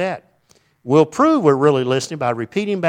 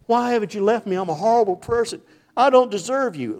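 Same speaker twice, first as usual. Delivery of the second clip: mock humble confession; 8 semitones higher than usual. Husband humbly addressing wife.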